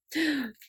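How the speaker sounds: long, high gasp; breath caught in surprise; delighted gasp. Real, not acted. Delight at favorite item.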